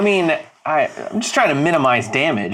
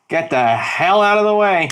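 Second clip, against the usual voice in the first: half-contained yell, slightly higher; mock yell; 4 semitones higher than usual. Player yelling at people on the bridge, contained mock yell. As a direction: yell a warning without full volume. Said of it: "I just yell in that direction".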